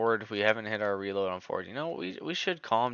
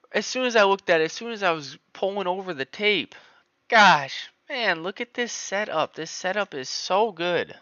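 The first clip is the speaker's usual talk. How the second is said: high, loud whining over the missed setup; whiny; 10 semitones higher, 6 dB louder than usual. Real, not acted. Trader whines to viewers about a missed entry. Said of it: "I'm sounding like a little whiny girl".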